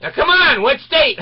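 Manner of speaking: high, loud pushback; insistent attendant. Retold or imitated. Attendant pressing the teen for his real state, loud. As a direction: insist there's no such place. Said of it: "He says".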